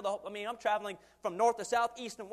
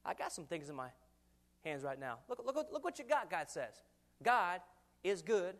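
usual speaking voice

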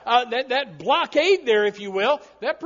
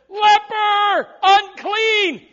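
much higher, louder call of warning; leper calling out; 9 semitones higher, 8 dB louder, much slower than usual. Pretend. Leper at the church door warning the congregation, loud call. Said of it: "opened the door and said".

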